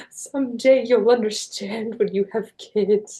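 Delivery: slow, imitated tearful voice; choked-up mom. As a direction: imitate a mother choking up as she speaks. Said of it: "she was choking up at the end".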